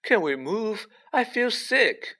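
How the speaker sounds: queasy plea. A narrator's reading. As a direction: plead to get away, high and drawn out. Queasy character begs to leave.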